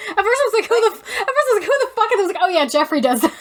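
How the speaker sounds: giggling while telling it; laughing. Real, not acted.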